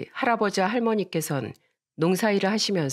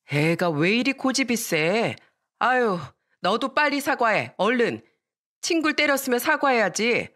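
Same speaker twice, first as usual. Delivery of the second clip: higher-pitched scolding neighbour mother; disapproving tutting; 5 semitones higher than usual. Acted. Friend's mother tuts at the stubborn girl, disapproving scolding. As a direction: chide the child with clear disapproval. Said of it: "친구 엄마는 그 모습을 보고 혀를 차며".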